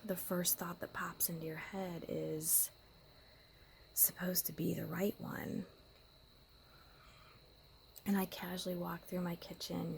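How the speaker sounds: hushed whisper. Real, not acted. Woman confiding about her angry partner in a whisper. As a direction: keep it to a secret whisper.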